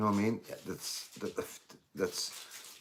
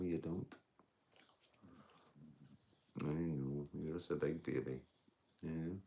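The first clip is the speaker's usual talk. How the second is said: soft, lower, cooing pet voice; 4 semitones lower, 9 dB quieter than usual. Real, not acted. Streamer cooing to his dog, soft tender baby talk.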